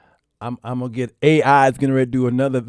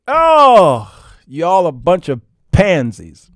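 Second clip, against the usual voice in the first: loud mocking; 7 dB louder, slower than usual.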